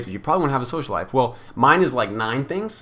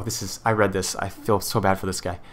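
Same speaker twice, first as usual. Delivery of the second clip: normal talking voice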